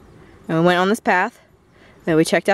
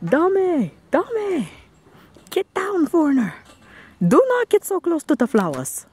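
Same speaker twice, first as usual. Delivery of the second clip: angry Japanese guard; 8 semitones higher than usual. Pretend. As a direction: yell at a tourist near flowers. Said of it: "No, I'm not doing a Japanese accent".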